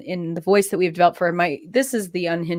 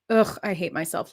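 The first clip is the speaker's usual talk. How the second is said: normal talking voice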